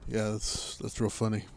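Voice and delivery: low, soft, deadpan murmur; a hint of menace under the joke; Splinter Cell voice. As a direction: play the creepy coworker. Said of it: "He always talks like".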